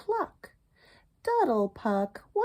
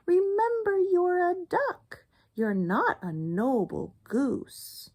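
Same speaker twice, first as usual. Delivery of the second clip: high, indignant goose voice; lightly voiced indignant goose; 11 semitones higher than usual. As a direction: lecture like an offended goose. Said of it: "flapped her wings indignantly".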